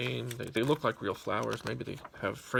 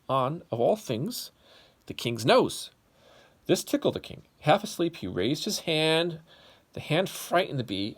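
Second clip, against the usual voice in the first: louder, animated storybook telling; lively story narration; 3 semitones higher, 7 dB louder than usual. A narrator's reading. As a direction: tell it big for the kids. Reads story to children.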